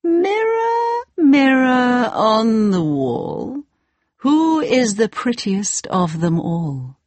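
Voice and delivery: high, slow queen voice; a slow, haughty queen; evil queen. Acted. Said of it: "Every day she said".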